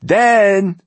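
loud, high, drawn-out word; irritated. A narrator's reading. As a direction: press on loudly with the threat. Old poet still berating the guard.

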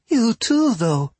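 a hurt little bard whining; high whimpery rabbit girl. Acted. Rabbit bard worries for Fia.

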